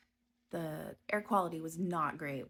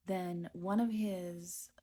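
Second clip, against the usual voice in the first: normal talking voice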